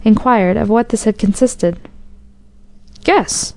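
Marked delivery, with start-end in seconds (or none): 3.0-3.5 high, chuckling cry of glee; delighted cry. A narrator's reading. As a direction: call it out with delight. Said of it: "cried the soldier, chuckling with delight".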